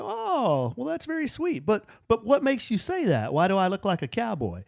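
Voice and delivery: put-on high, sweet coo to a little boy; touched dad. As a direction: melt a little, then ask gently. Dad's touched reply to son.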